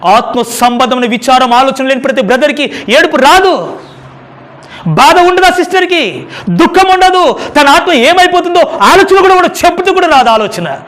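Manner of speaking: high, loud impassioned preaching; preaching at full force. Acted. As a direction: press the point hard on the brothers and sisters. (ఉద్వేగంతో బోధించడం)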